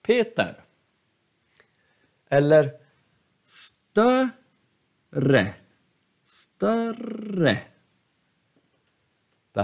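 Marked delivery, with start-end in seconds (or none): 0.0-0.6 Peter with high stressed Pe, falling ter; Peter, first syllable up, then down; acute accent. Shown as a demonstration. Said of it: "Samma är det med". 3.4-7.6 större drawn out, up then down; slow demo of större, first syllable high; acute accent. Shown as a demonstration. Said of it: "Den går upp och sen går den ner".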